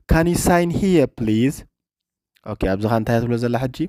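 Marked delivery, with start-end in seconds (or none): 0.1-1.7 slow, careful English with stress on room; slow repeat for learners. Shown as a demonstration. Teacher repeating a clip line slowly for students, slow and careful. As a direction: say it slowly so the class can copy.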